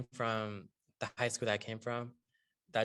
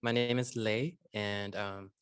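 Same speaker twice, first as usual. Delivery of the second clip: ordinary talk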